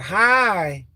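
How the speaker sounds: a high, light mock hi; imitating her hi. Mimicking the woman's short greeting. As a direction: chirp it like she did. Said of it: "She said".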